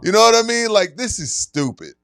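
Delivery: high, loud, fed-up complaint; loud and high with disbelief; exasperated outburst. Real, not acted. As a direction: throw up your hands vocally. Exasperated rant.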